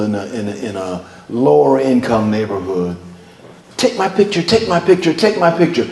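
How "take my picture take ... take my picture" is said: put-on high, eager child voices in quick repeats; mock pleading children, raised pitch; clamoring kids. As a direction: imitate kids pestering for attention. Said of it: "Kids come out of the woodwork".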